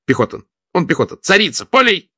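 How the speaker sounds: rising, high cheerful boast. A narrator's reading. Vladimir cheerfully explaining Vakhtang's service, high and rising. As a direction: proudly announce the infantry. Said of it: "весело пояснил Владимир".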